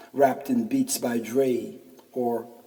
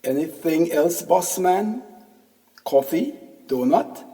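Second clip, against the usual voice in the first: lightly imitated Indian accent, louder; 6 semitones higher, 6 dB louder than usual. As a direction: Indian accent shopkeeper, higher pitched. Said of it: "has a thick Indian accent".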